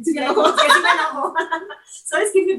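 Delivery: loud laughing admission. Real, not acted. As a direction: laugh as anger comes back. Recalling a real annoyance.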